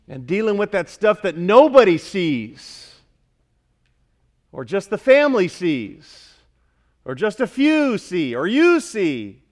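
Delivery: normal talking voice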